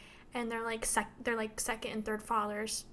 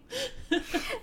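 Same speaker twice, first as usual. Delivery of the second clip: a much higher falling laugh; high burst of laughter; 12 semitones higher than usual. Real, not acted. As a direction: crack up. Laugh after book quote.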